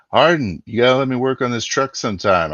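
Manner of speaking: excited about the truck. Acted. Excited character calls to driver.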